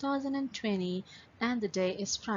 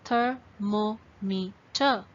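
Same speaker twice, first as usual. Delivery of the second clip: "thermometer" slowly, one syllable at a time; four spaced beats on "thermometer"; broken into syllables; much slower than usual. Shown as a demonstration. Teacher shows students the count, "thermometer" split into syllables. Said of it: "So you see".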